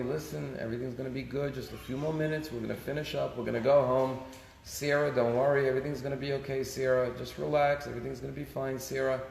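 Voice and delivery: softly imitated patient mother soothing herself; calm mother. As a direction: keep it quiet and reassuring, like a mother holding calm. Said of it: "the mother's just calmly".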